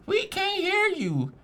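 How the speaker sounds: very high, slow whiny mimic of the heckler; mocking girl voice. Girl in the front row heckling him, high whiny mimic. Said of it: "She was like".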